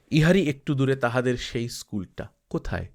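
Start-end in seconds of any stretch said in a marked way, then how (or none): none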